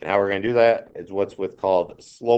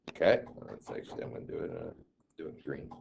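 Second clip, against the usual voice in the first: quiet aside; 3 semitones lower, 11 dB quieter, much faster than usual. Real, not acted. Muttering aside while choosing pen.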